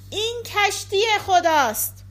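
high, loud proclamation; loud cry. A narrator's reading. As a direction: call it out aloud, pitched well up.

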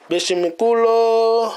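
slow, drawn-out mock Spanish "Beshe mi culo". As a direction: stretch "Beshe mi culo" slowly in a fake Spanish accent. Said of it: "if I learn Spanish".

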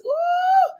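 high shouted ooh; high ooh cry. Real, not acted. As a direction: cry out in the spirit. Preacher exclamation.

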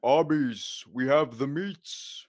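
big, slow, drawn-out commercial announcer voice; Arby's guy. Shown as a demonstration. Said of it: "I can also do, um, the Arby's guy".

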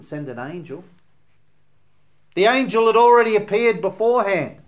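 loud, high, urgent pulpit voice; preaching at full force. Acted. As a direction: drive the question home to the congregation.

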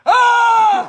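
loud high mock scream; retold shriek of shock; panicked scream. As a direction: scream it out as the storyteller. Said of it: "he's freaking out. I'm freaking out".

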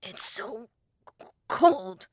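shivering, teeth-chattering stutter; Link. A narrator's reading. Shivering boy thinking in the snow, stuttering. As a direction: stammer from the cold with a loud burst. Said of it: "Link shivered uncontrollably".

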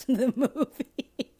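laughing high through the words; words breaking into laughter. Real, not acted. Laughing at herself.

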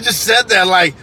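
normal talking voice

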